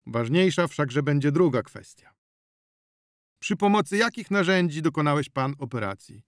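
a stern officer laying out the case; official policeman. Acted. Policeman interrogates the healer. (urzędowy ton policjanta)

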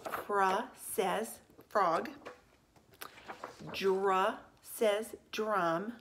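very slow, each blend sounded apart, "Fra ... says ... frog"; blend sound demo. Shown as a demonstration. Teacher showing pupils a blend pattern, slow phonics demo. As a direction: model "Fra" and "Dra" slowly before "frog" and "drum". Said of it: "See if you can see a pattern".

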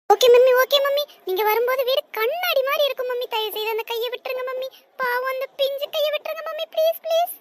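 fast, rising begging; frantic pleading. Acted. Child promising mother a clean house to free her hand, frantic pleading. Child begs for hand's release.

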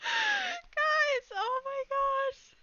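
high, tearful squeak; drawn-out happy whimper; overwhelmed, near tears. Real, not acted. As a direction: squeak it out as if about to cry. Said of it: "I'm gonna cry".

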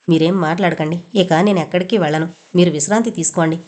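plain reading voice

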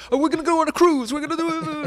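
high, fast, loud string of promises; hammed-up boyfriend gushing desperate promises; mock frantic promising. Boyfriend promises girlfriend the world, frantically pleading.

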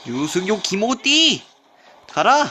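slow, loud hyped gamer yell; rally yourself mid-fight; battle cry. Real, not acted. (게임 중 신나게 외치는 기합)

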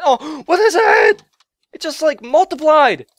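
loud, high burst of alarm; gasp, then yell in shock; shocked outcry. Real, not acted. Gamer watches creature multiply, shocked shouting.

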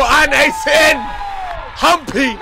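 high, loud goal call; goal shout. Real, not acted. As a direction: erupt as the ball hits the net. Live goal call, fan commentator.

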